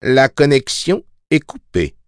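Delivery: high, pleasant announcement voice; recorded hostess delivering a notice; aimable voix de femme. A narrator's reading. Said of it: "répondit l'aimable voix de femme". (voix aimable et aiguë d'hôtesse)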